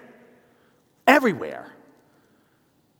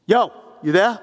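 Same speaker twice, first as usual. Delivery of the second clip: loud, raised check for a response; calling down the line; 6 semitones higher, 8 dB louder than usual. Retold or imitated. Caller prodding silent receptionist, loud and insistent. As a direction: call out to someone silent on the phone.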